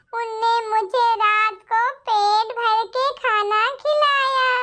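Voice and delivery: usual speaking voice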